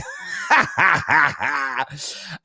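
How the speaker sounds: helpless cackling laugh. Real, not acted. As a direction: crack up at your own impression. Host cracks up at fake accent.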